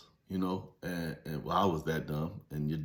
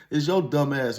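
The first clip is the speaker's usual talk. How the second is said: ordinary talk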